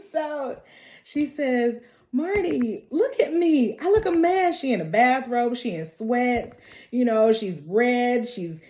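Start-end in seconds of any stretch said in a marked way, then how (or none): 2.1-4.6 high-pitched imitated plea; pleading protest. Courtney, sick in a bathrobe, tells Marty, high mock pleading. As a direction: act out an embarrassed appeal, voice raised in pitch.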